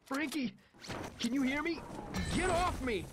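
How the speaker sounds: high, frantic calling out; terrified man calling to his possessed friend; panicked plea. A narrator's reading. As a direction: beg him to stop, in panic. Narrator calling to attacker.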